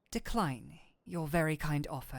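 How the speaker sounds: normal talking voice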